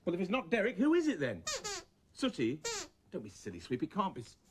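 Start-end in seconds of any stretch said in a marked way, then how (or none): 3.1-4.4 low, soft brush-off; dismissive. Acted. Man telling Sweep it can't be Sooty, dismissive. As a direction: wave the silly idea away, low.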